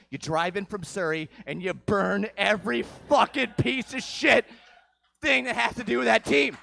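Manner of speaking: rising from emphatic to a loud shouted rant; building rant. Acted. Comic ranting to the audience, building to a shout. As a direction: work the crowd into a shouted frenzy.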